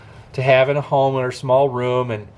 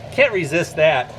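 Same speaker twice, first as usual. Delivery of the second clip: ordinary talk, nothing marked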